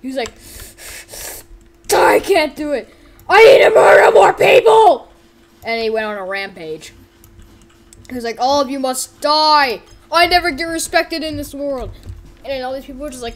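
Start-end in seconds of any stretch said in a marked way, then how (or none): 1.9-5.1 high, loud outburst building to a scream; over-the-top crazed yell; frenzied shouting. Pretend. Character snaps before rampage. 8.4-11.9 loud, raised villain tirade; hammed-up shouted grievance; angry rant. Rampaging character rants at everyone.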